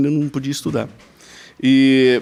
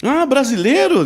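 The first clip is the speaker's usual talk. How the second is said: high, drawn-out exclamation; imitating a woman thrilled at a discovery; hammed-up happy recognition; delighted surprise; 10 semitones higher, slower than usual. Stranger delighted to hear a Brazilian.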